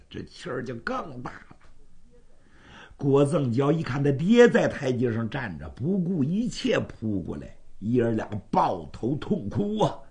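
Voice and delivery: ordinary delivery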